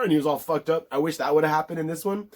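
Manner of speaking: ordinary talk, nothing marked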